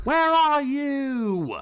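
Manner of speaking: long drawn-out high calls; shouting a name. Acted. Troubled brother shouting for his sister, calling out. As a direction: holler far and high for someone missing. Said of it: "who was shouting their sister's name".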